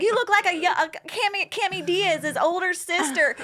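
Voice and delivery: high, lightly acted quote; retold celebrity compliment. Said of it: "and he's like".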